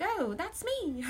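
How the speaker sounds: high, drawn-out mock British; terrible British accent. Viewer recognizing themselves in the reading, in a bad British accent. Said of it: "worst British accent ever".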